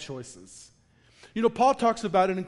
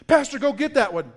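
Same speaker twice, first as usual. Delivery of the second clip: calling across; 5 semitones higher, 7 dB louder than usual. Retold or imitated. Friend yells across the ice.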